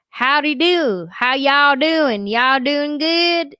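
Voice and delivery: slow, high mock Southern drawl; hammed-up Southern country accent, vowels stretched out. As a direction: imitate a foreigner overdoing country American. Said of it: "real Southern country".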